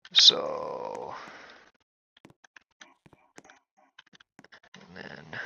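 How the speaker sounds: soft, breathy whisper. Real, not acted. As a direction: whisper it, hushed. Whispering in the hospital.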